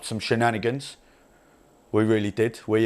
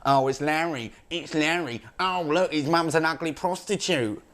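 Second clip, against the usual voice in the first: higher jeering mock voice; mocking blokes at the pub; 6 semitones higher, slower than usual. Lads in the boozer mocking Larry, jeering mock voice. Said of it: "it's like".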